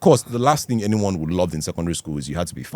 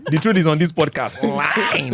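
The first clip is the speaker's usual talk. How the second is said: laughing shout; 7 semitones higher than usual. Real, not acted. Laughing proclamation.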